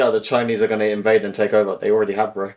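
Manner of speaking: ordinary talk, nothing marked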